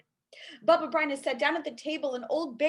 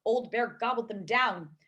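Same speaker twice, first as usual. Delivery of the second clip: ordinary delivery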